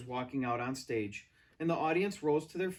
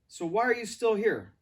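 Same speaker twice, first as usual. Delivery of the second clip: loud, high, angry question; 7 semitones higher, 6 dB louder than usual. A narrator's reading. Director angrily asks the girl, loud. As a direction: snap at her in anger. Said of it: "Davenport said to me angrily".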